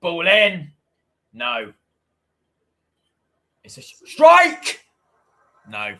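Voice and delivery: lively football commentary, pushing on the chances; commentating the final minutes for the viewers, eager play-by-play. Acted. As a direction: call the match like a TV commentator. Does own live match commentary.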